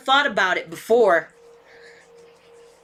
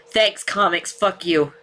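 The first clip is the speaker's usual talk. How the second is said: normal talking voice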